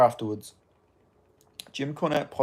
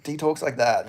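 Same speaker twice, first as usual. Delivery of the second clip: usual speaking voice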